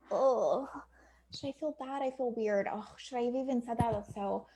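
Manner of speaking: softly acted embarrassed inner voice; second-guess yourself under your voice; awkward self-doubt. Voices client's awkward self-doubt after brush-off.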